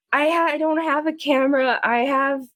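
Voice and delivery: high-pitched, put-on distressed complaint; panicked Willow. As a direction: play a big man yelping under butterfly attack. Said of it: "He's like".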